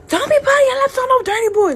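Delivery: high, loud, fast imitated telling-off; Caribbean mother scolding. Mother warning daughter after the beating, imitated loud scolding. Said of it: "now a Caribbean mother".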